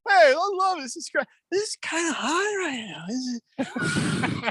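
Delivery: high, chirpy cheer; cheerful newcomer. Retold or imitated. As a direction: chirp like someone loving the heat. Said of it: "They're like".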